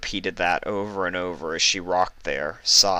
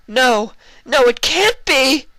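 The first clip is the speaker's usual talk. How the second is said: high, loud horrified cry, slow at the end; terrified denial; 9 dB louder, slower than usual. Acted. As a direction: scream it in disbelief. Luna sees Nightmare Moon return.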